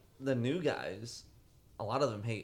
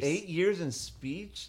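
drawn-out disbelief, pitched up; higher, slow, astonished questioning; incredulous; 5 semitones higher, slower than usual. Real, not acted. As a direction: draw it out slowly in disbelief. Incredulous at the confession.

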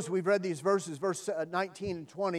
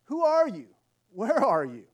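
raised voice, pitch jumping on the first question; calling out questioningly; 4 semitones higher, 5 dB louder than usual. Acted. Man on the cliff questions the voice above, calling out. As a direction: call up to an unseen stranger. Jack calls to unseen voice.

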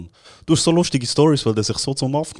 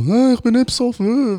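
higher, lightly voiced drunk girl; drunk insistence; 9 semitones higher than usual. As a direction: imitate her insisting she's sober. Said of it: "Und dann sie so".

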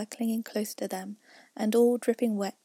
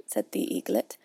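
ordinary delivery

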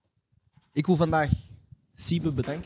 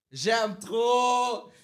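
excited; 7 semitones higher, much slower than usual. Real, not acted. Excited repeated exclamation.

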